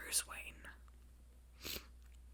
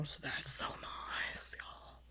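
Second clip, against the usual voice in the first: hushed, secret-sharing whisper; 6 semitones lower, 11 dB quieter, slower than usual. Real, not acted. Whispering a day-off secret to her viewers, hushed. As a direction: breathe it like good news you can't say aloud.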